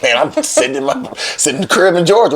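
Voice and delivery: high-pitched, defensive comeback; retold protest. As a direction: act out pleading your own innocence. Retelling his protest back to her.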